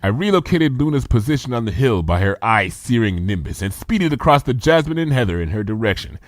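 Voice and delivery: ordinary delivery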